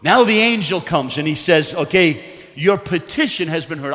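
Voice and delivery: loud, high, driving sermon; impassioned pulpit delivery; full-force preaching. Real, not acted.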